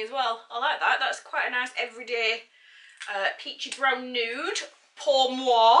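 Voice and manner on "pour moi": mock posh French; a playful posh pour moi; gone posh. Real, not acted. Trying out a product, playful mock posh. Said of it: "Oh, I've gone posh".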